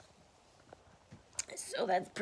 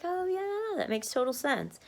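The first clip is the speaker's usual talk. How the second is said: realizing; 10 semitones higher than usual. Retold or imitated. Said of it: "I'm like".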